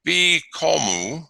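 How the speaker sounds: "bikomu" drawn out slowly, each syllable clear; slow syllable demo. Shown as a demonstration. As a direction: stretch "bikomu" out for the class. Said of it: "to is to fly".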